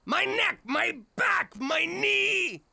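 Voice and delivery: high, hammed-up pain cries; fake injury wails. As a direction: ham up a whiplash claim. Faking injuries for rich man.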